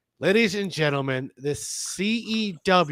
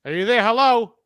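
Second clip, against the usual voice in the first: louder, higher hello down the line; shouting into a phone; 3 semitones higher, 5 dB louder than usual. Real, not acted. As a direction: call out to a silent caller. Calling a guest on call.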